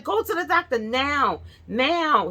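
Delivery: high, insistent order from the husband; urgent husband. Retold or imitated. Husband orders her to the doctor, high and insistent. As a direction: push her to go, now. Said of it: "He was like".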